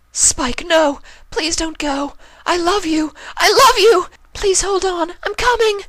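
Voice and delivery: very high, loud anguished calling; desperate cry. Acted. Rarity crying out after Spike, very high and loud. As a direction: scream after him as he slips away.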